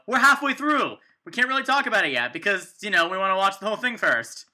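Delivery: loud, high mock-excited podcast hosts; hammed-up halfway-through excitement. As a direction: gush it out like a live mid-show update. Mock live intermission podcast.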